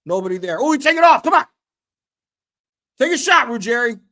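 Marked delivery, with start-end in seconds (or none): 0.6-1.5 excited yell. Real, not acted. Live match, excited call. 3.0-4.0 urging shout. Real, not acted. Urging player during match.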